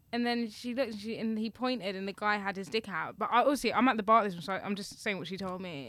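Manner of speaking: normal talking voice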